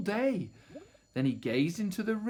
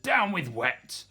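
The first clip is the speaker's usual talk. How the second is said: slow grumpy chant, a little raised; muttering; 4 semitones higher, 4 dB louder than usual. A narrator's reading. Tyrannosauruses who can't swim, slow grumbling. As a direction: drag out the muttered protest. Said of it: "And they muttered".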